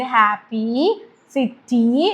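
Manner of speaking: ഹാപ്പി slowly, with the final ee clearly stretched; long ee demo. Shown as a demonstration. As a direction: draw out the ending of ഹാപ്പി. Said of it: "നീട്ടുന്ന ഇ ആണ് വരുന്നത്".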